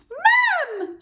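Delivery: high, loud tattling shriek. A narrator's reading. Peter shrieks for Mum about Henry, high and loud. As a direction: shriek for Mum to tell on him. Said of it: "shrieked Peter".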